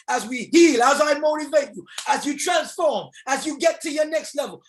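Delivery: loud, high, fired-up preaching; rally the crowd, full voice; preaching at full force. Acted. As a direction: revival preacher shouting. Motivational preaching climax.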